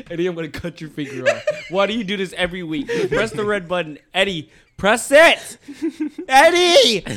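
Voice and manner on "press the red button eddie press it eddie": exasperated shout. Real, not acted. Host ordering Eddie to press the button, exasperated shouting.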